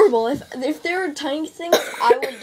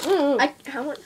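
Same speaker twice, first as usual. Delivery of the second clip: usual speaking voice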